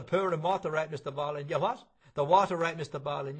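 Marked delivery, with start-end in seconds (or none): 1.5-1.9 put-on deaf old man. 2.1-3.4 louder repeat. Acted. Repeated louder to deaf man.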